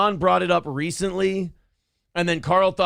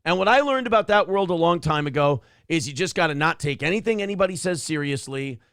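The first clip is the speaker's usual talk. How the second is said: ordinary talk, nothing marked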